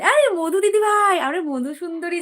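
light up as a friend arrives; greeting Madhu as she joins the live, with delight; excited welcome. Real, not acted.

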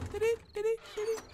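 very high little repeated sound; imitated horror-movie noise. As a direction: mimic the creepy effect up high. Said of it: "It was like something out of".

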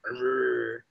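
low imitated dog growl. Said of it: "he actually kinda growled".

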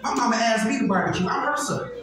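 usual speaking voice

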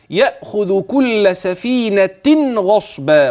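سفينة ending with an audible nun before غصبا; slow recitation with the tanween nun; clear tanween nun. Shown as a demonstration. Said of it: "وأبين النون الملفوظة".

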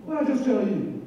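mock sheriff threat. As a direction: lean in as the armed sheriff. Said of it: "he puts his hand on his gun butt".

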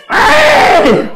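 high, loud shriek; sudden yelp of alarm; startled yelp. Acted. Magician startled mid-trick with child volunteer, high shriek.

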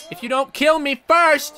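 ordinary delivery

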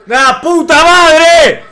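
high, drawn-out swearing; frustrated shouted curse. Real, not acted. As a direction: curse loudly, stretching the words. Insulta mirando el partido. (puteada gritada y estirada)

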